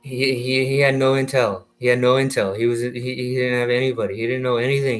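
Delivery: ordinary talk, nothing marked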